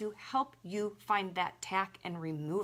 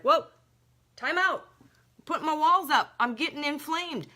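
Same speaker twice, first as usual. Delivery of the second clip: higher, louder startled protest; alarmed body voice; 7 semitones higher, 8 dB louder than usual. Retold or imitated. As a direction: voice the body crying time out.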